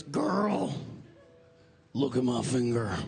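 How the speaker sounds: low, raspy party guy. Retold or imitated. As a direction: show off the injury, sassy.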